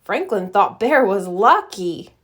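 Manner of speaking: usual speaking voice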